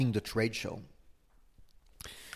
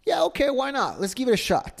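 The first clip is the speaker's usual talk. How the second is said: upbeat American; 13 semitones higher, faster than usual. Retold or imitated. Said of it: "they're like".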